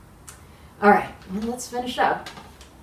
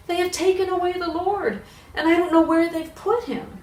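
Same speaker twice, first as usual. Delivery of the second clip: high, urgent cry of alarm; Mary's frantic report; 11 semitones higher, 4 dB louder than usual. Acted. Mary crying to the disciples, high and alarmed. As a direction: rush the news out in high panic.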